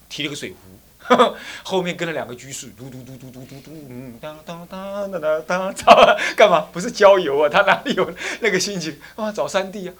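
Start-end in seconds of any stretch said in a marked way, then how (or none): 2.7-6.2 mock engine noise. Imitating scooter engine.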